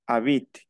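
"habit" ending on T, no E; silent final E. Shown as a demonstration. Teacher explains the rule, "habit" with silent final E. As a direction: say "habit" stopping at the T. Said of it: "la E final no se pronuncia".

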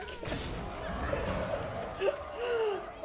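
high breathy weasel cackle; weasel laugh. Pretend. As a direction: squeak out a sneaky weasel laugh. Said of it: "You guys love my weasel impression".

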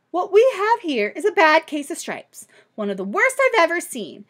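a sweet granny giving the verdict; kind old woman. Acted. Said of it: "she said".

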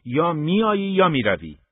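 loud and high, no room to argue; angry ultimatum. Acted. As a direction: slam the door on the argument. Father issues ultimatum.

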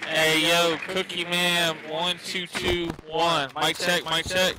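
loud mic check. Pretend.

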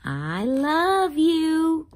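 tender. Acted. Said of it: "and it says".

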